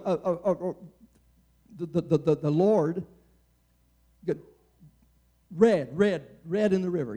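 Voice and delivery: stammering, repeating first sounds; hammed-up stammer imitating Moses; mock stutter. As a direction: stumble over every word like a stutterer. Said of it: "let a stutterer go start talking".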